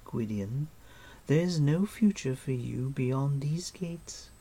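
high, lilting, persuasive queenly voice; silky queen. Acted. Queen tempting her captive with a bargain, silky. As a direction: coax with regal poise.

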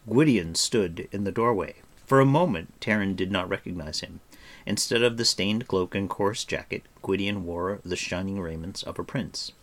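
ordinary delivery, nothing marked

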